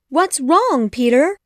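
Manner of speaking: Wendy alarmed at Peter crying; worried. A narrator's reading. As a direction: ask in sudden alarm. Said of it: "she asked, becoming very worried".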